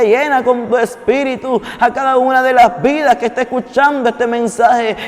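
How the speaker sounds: preacher praying over the listeners, rising; fervent prayer. Acted. Preacher praying for every viewer, growing fervent. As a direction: pray with growing fervor.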